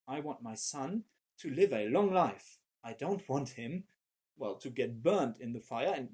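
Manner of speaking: ordinary delivery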